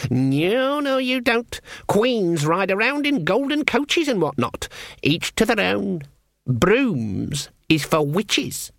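put-on high-pitched scornful old witch; bossy old witch. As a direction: forbid it in a high, haughty voice. Voices Granny forbidding the broomstick.

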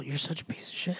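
whispered under the breath; muttered insult. Real, not acted. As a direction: hiss it under your breath.

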